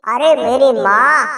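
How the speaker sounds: husband demanding water from wife, impatient. Acted. Husband exasperated, slow.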